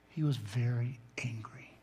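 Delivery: unvoiced whisper, leaning in close. Real, not acted. Pastor to congregation, revealing what the verb means, in a whisper. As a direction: hush it like a secret revealed. Revealing the verb's hidden meaning.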